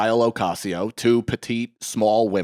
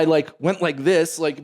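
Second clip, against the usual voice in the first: usual speaking voice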